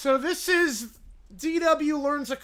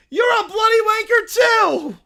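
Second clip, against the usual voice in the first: put-on high loud childish yelling; bratty kid screaming; 12 semitones higher, 13 dB louder than usual. Caillou shouting curses at his co-hosts on air. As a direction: scream the insults like a tantrum.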